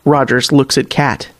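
usual speaking voice

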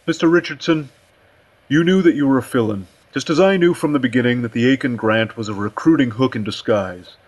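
chairman's put-on voice, lecturing a subordinate; dismissive chairman. Aussie tells Richardson the job is filled, dismissive. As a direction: put him firmly in his place.

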